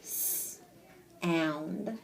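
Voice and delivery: soft hissed "s-", pause, then "and"; word broken in parts. Shown as a demonstration. Teacher showing how to break a word, sounded out. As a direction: separate the "s-" sound from the "and" chunk. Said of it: "next time you break this one".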